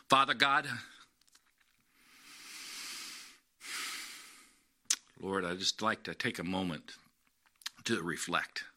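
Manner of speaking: low, soft, unhurried prayer voice; bow your head and pray gently with the congregation. Acted. Pastor leading the congregation in prayer, soft and low.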